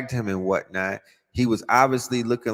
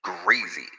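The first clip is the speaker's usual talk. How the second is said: ordinary delivery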